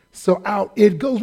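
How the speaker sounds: usual speaking voice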